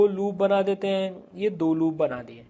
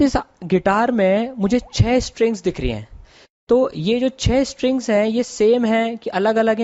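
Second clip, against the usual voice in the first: louder, higher presenter energy; upbeat interviewer; host voice; 4 semitones higher, 8 dB louder than usual. Acted. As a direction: introduce the guest like a show host. Presenter interviews guest guitarist.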